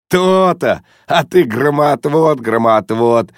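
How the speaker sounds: high crow settling into playful chant; cheerful. A narrator's reading. Guest gloating to his friend after the visit, cheerfully. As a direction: crow merrily over the victory. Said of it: "весело проговорил Лухин".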